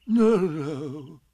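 feigned nonchalance, sung out lightly; careless. A narrator's reading. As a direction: brush it off with a drawn-out no. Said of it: "said Pooh carelessly".

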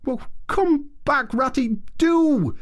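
high, slow, pleading creature voice; Mole crying out. A narrator's reading. Mole begging Ratty to turn back, high pleading cry. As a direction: beg him to come back. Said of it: "cried the Mole".

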